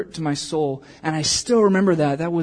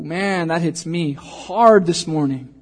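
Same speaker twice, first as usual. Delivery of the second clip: ordinary talk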